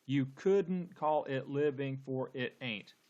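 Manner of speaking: slow, beat-by-beat verse reading; deliberate, measured line of verse; syllable-counting reading. Shown as a demonstration. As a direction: count out each syllable of "couldn't call it living". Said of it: "let's count the number of syllables".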